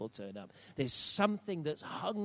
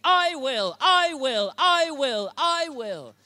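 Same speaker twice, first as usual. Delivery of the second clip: God's firm promise; 12 semitones higher, 10 dB louder, much slower than usual. Retold or imitated. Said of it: "But he's saying".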